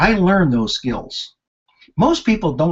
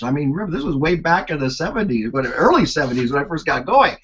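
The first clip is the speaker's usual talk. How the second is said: ordinary talk, nothing marked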